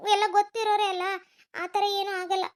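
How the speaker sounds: ordinary talk, nothing marked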